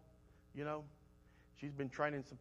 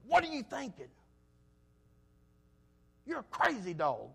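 scolding the dog; 5 semitones higher, 5 dB louder than usual. Retold or imitated. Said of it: "I said".

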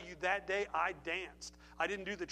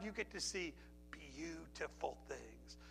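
hushed refrain; 4 semitones lower, 11 dB quieter, slower than usual. Acted. Sermon refrain softened.

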